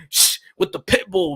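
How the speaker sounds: ordinary talk, nothing marked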